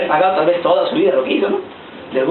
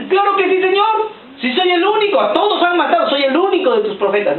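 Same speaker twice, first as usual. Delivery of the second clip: much higher whiny voice with a laugh; self-pitying prophet; 10 semitones higher than usual. Retold or imitated. Elijah insisting to God he is the only one, high whiny laugh. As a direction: whine like a proud, sulky prophet.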